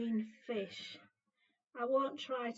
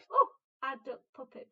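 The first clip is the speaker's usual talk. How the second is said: excited reveal; 10 dB louder, faster than usual. Acted. Showing finished puppet to kids.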